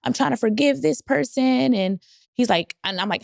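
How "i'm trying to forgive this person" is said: retold crying. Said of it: "I, like, start crying and I'm like".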